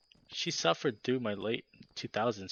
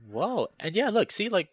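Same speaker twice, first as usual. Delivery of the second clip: much higher, louder pointing out; excited whoa; 10 semitones higher, 6 dB louder than usual. Real, not acted. Streamer showing chat the character art, excited. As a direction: point eagerly at the screen. Reacting to artwork.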